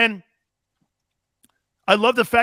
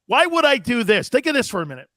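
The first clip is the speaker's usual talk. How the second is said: ordinary talk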